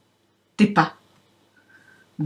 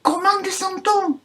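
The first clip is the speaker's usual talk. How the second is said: eager question; 10 semitones higher, 3 dB quieter than usual. Acted. Lapin pressé demande.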